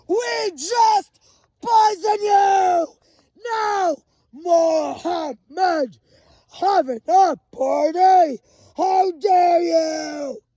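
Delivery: high, loud shouting, slowing and nearly crying at the end; furious yelled accusation. Retold or imitated. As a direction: scream it at her like a charging zombie. Attacker rushing at her, retold.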